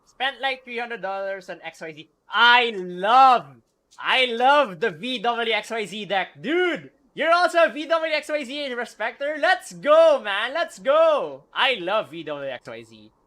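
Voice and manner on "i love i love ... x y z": excited fan. Real, not acted. Fan gushing over favorite deck.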